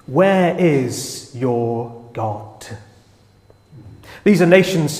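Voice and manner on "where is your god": drawn-out, slow mocking question; mocking taunt. Nations mocking Israel, a slow scornful taunt. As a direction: taunt the believers, dragging every word. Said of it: "they mock and say".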